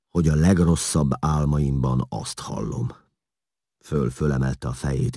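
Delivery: plain reading voice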